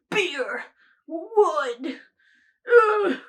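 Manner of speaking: put-on manly scent-label voice, ending in a loud grunt; men's product marketing. Mocking men's deodorant scents, put-on macho marketing voice. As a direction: punch each word as mock-macho branding. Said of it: "I don't know why my voice is doing that".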